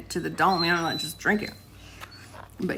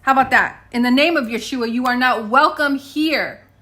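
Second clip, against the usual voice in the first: stern spoken rebuke; commanding rebuke; 4 semitones higher, 6 dB louder than usual. Shown as a demonstration. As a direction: cast it out with authority. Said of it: "That's how you do that".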